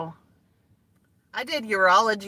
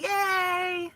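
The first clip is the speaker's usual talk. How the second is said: excited greeting; 8 semitones higher, 4 dB louder, much slower than usual. Real, not acted. Greeting viewers joining.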